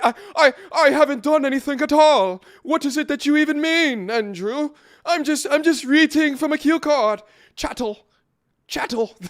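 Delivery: high-pitched mock-British protest; hammed-up British accent, loud and high; terrible British accent. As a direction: play an indignant Brit in a badly faked accent. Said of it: "That was a terrible accent".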